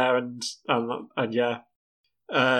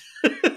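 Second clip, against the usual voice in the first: high laugh. Real, not acted. Laughing at Pokedex joke.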